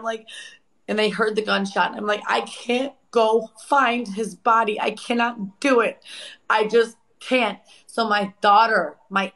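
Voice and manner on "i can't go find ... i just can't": higher, slow, anguished refusal; retold distress. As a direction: re-enact being too distraught to go on. Re-enacting her panicked refusal to look.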